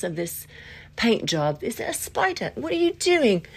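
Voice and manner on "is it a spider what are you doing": high-pitched alarmed questioning; suddenly rattled; startled. Real, not acted. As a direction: react to a sudden spider, voice jumping up. Startled by a spider mid-talk.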